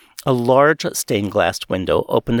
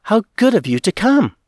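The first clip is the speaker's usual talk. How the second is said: higher, bright with delight at seeing him; joyous cry; 10 semitones higher, 3 dB louder than usual. Acted. Woman greeting her brother-in-law in joyous surprise. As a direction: call out his name in glad surprise. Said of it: "I cried out joyously".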